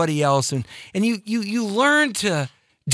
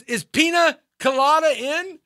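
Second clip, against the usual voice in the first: mock bar call; 9 semitones higher than usual. Pretend calling at the bar.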